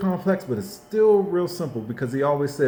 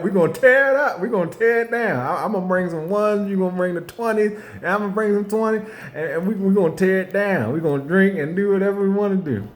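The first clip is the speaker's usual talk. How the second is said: hyped party talk; 7 semitones higher, faster than usual. Retold or imitated. Mimicking partygoers planning strip club.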